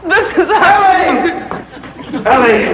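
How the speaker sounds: high, slow, swooning cry of her name; a smitten suitor gushing with joy; rapturous lovestruck cry. Acted.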